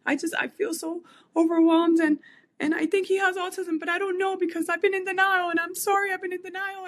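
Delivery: high, loud tearful pleading; overwhelmed mom apologising to the stylists, crying. Retold or imitated. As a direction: plead through tears, apologising. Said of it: "I just began to cry".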